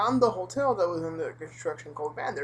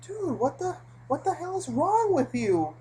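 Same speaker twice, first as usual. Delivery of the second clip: outraged disbelief; 10 semitones higher than usual. Retold or imitated. Narrator outraged at guard.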